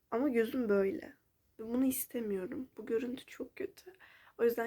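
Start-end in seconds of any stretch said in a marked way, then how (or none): 2.8-3.9 soft sad whisper. Real, not acted. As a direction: whisper it, upset. Quiet night talk about eye.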